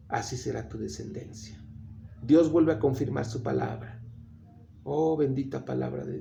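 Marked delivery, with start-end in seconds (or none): 0.1-1.6 hushed, low promise. Acted. Preacher voicing God's promise to Abraham, low and hushed. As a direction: lower and much softer, solemn God's promise.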